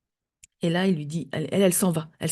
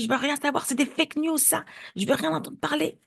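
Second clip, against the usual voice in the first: high-pitched dismissive rant; retold yelling; 8 semitones higher, 4 dB quieter than usual. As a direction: imitate an official's outburst with raised pitch. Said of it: "il hurle".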